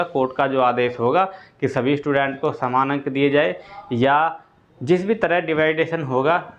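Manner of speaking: ordinary talk, nothing marked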